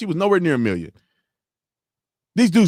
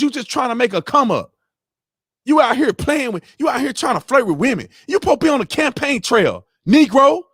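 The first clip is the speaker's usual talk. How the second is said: angry rant; 7 semitones higher than usual. Real, not acted. Ranting at the candidate.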